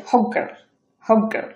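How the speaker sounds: put-on "hot girl" as hog-girl, T unreleased; glottal stop. Shown as a demonstration. Teacher drills the phrase, "hot girl" as hog-girl. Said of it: "একটা ধাক্কা খেয়ে পরে".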